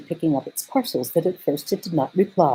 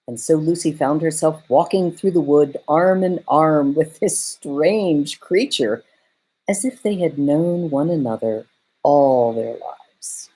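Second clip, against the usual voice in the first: ordinary delivery, nothing marked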